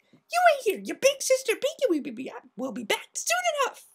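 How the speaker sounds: very high, chirpy; cheerful big sister to a foal; Pinkie voice. A narrator's reading. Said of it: "hugged the unicorn foal happily".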